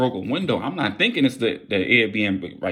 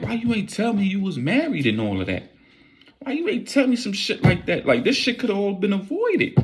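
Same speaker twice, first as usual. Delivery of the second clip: whiny complaint; 10 semitones higher than usual. Retold or imitated. Blaming woman, high pitched.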